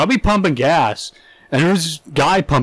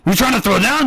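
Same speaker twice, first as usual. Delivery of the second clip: same taunt tossed again, a bit higher; higher repeat of a mock gangster dare; mock gangster challenge, repeated; 8 semitones higher than usual. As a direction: keep the hammed-up tough-guy taunt going. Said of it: "gangster quarrel".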